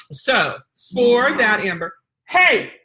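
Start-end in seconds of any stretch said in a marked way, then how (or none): 2.3-2.7 sudden loud, drawn-out hey; interrupting call. Shown as a demonstration. Trainer interrupting dogs at play, loud attention call. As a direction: cut in sharply to get attention. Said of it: "That's how I can interrupt".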